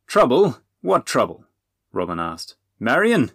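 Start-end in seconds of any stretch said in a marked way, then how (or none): 0.1-1.5 loud, high, startled; sudden burst of worry; alarmed question. A narrator's reading. Robin alarmed at news of trouble. 2.8-3.3 loud, high, urgent name call; worried shout; alarmed call. A narrator's reading. Robin calls anxiously for Marion.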